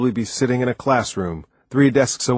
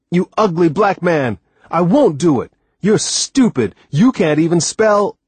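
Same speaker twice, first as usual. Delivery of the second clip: much higher and louder furious outburst; screaming; 7 semitones higher, 5 dB louder than usual. A narrator's reading. Frustrated blind boy lashing out at Timothy, screaming. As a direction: yell it all out in a tantrum. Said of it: "and screamed".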